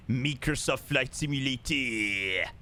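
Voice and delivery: slow, hammed-up French accent on "Microsoft Flight Simulator"; mock French accent. Streamer to chat, in a mock French accent. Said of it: "trying to put on a French accent".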